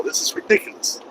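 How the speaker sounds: whispered exasperation; muttered aside. Retold or imitated. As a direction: breathe it out under the breath. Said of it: "I'm like".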